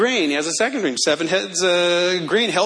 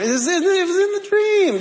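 incredulous; 13 semitones higher than usual. Real, not acted. Disbelieving emphasis, high.

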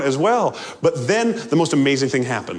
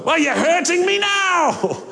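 hurt ghost; 13 semitones higher than usual. Acted. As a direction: wail it like it stings. Ghost complains to angel.

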